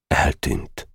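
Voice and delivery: soft whispered word; hushed disappointment; quietly. A narrator's reading. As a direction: let it drop quietly. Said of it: "mondta csendesen".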